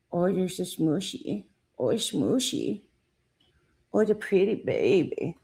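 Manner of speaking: cooing pet voice, soft and doting. Real, not acted. Cooing over her dog.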